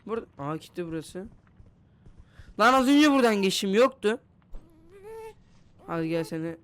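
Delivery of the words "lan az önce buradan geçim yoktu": loud, high disbelieving exclamation; startled outburst. Real, not acted. Player finding a passage that wasn't there, loudly startled. Startled by changed game map.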